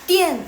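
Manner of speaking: Dian high and loud, falling sharply; fourth tone. Shown as a demonstration. Teacher demonstrating the tone of Dian, falling fourth tone. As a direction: start Dian high and drop it hard. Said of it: "dian itu nada keempat".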